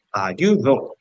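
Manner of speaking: "Ayudó" with a strong final DÓ; stress on final syllable. Shown as a demonstration. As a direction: punch the last syllable of "Ayudó". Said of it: "la última es la que más suena".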